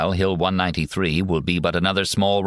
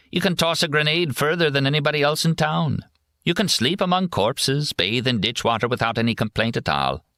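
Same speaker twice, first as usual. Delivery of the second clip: higher, sardonic GI voice; mocking soldier voice; 9 semitones higher than usual. A narrator's reading. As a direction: sell it with mock cheer. Kerrigan mocks army skills.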